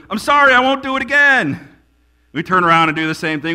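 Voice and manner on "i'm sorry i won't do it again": put-on higher, louder whiny kid apology; child's sing-song sorry to mom and dad; kid apologizing to parents. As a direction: plead like a caught child. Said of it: "and do it again and go".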